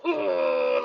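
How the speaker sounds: mock sulking little boy; little boy whine. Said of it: "like a little boy".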